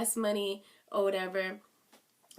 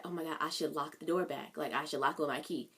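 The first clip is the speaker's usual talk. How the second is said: hushed; 7 semitones lower, 9 dB quieter than usual. Retold or imitated. Sneaking out at midnight.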